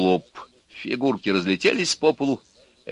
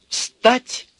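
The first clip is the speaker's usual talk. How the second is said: very high, loud order; authoritative command; 3 dB louder than usual. A narrator's reading. Bespectacled man ordering Urka up, commanding. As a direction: command him to his feet. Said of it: "скомандовал очкарик довольно властно".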